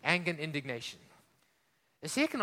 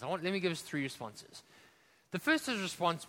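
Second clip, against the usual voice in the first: normal talking voice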